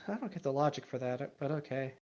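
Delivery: ordinary talk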